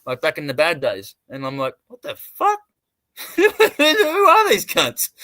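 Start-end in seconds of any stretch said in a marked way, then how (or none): 1.9-5.1 very high, incredulous squawk with a laugh; retold disbelief. Recalling his shock at being talked about, laughingly incredulous. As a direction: draw out the question, high and laughing.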